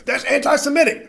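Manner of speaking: high, loud, laughing squeal; mock accuser. Mocking accusers again.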